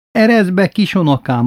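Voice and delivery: high, coaxing granny plea; pleading grandmother. Retold or imitated. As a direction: coax the boy from outside. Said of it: "mondta nagymama".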